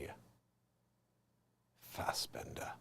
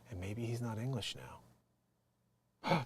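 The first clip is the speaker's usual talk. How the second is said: ordinary talk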